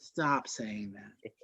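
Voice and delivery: slow, whispered imitation; hushed, slow mock exasperation; whispered plea. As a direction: whisper it with drawn-out words. Repeating Banner's plea for effect.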